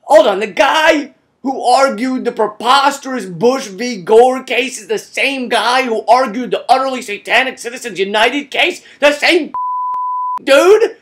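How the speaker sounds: building from puzzled to aghast; mock disbelief. Comedian to listeners, in growing mock disbelief.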